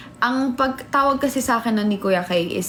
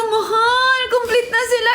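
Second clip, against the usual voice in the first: panicked alarm; 12 semitones higher than usual. Retold or imitated. Retelling her panic to her partner about the waiting group, high alarmed wail.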